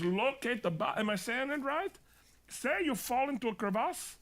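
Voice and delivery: high put-on Swiss accent; Swiss guide accent. Swiss guide checking his wording with a client, accented. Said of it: "that's the Switzerland guy".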